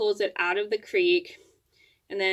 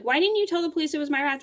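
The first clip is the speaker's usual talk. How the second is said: higher, rushed mock scolding; retold mad mom; 8 semitones higher, faster than usual. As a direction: imitate an upset mom demanding answers fast. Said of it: "mad at me because I didn't tell".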